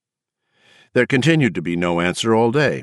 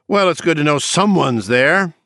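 sarcastic; 8 semitones higher, 4 dB louder than usual. A narrator's reading. Anne sarcastic on phone.

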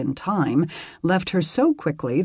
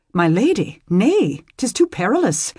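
put-on appalled young squire; 5 semitones higher, 4 dB louder than usual. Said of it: "The boy looked appalled".

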